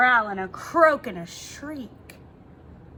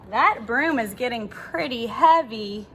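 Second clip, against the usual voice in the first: ordinary delivery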